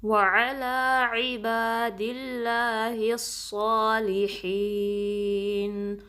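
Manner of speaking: very slow, drawn-out recitation of the greeting. Shown as a demonstration. Teacher reciting the phrase for students to repeat. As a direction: stretch each vowel in a measured chant. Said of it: "آئین کو درمیانے حلق".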